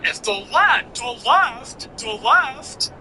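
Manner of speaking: high put-on voice mocking the left talk; mock caller voice. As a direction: sneer it out like a silly caller.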